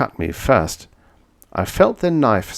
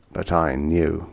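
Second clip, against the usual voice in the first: ordinary delivery, nothing marked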